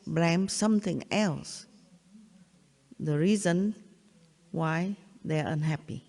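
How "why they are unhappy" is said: ordinary talk, nothing marked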